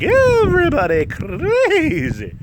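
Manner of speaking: slow high hype; hyped announcer. Acted. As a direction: sell it like a wild promo. Hyped promo delivery.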